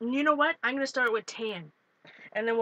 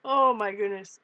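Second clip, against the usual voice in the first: ordinary delivery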